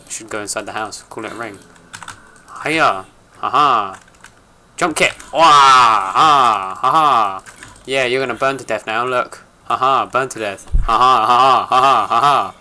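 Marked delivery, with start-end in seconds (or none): 2.6-12.5 shouting battle cries and laughs. Acted. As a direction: yell it like a kung fu fighter. Fighting mobs in Minecraft.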